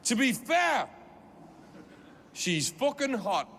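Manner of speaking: angry heckle. Retold or imitated. Geordie man in the second row heckling the comic, angry shout. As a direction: point and bark it out. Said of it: "really angry like this".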